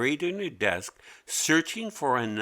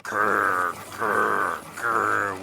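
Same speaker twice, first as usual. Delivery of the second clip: low, louder growled grr; sharpener grinding grr; 3 semitones lower, 4 dB louder, much slower than usual. A narrator's reading. Pencil sharpener grinding noise, low growl. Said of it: "went the sharpener".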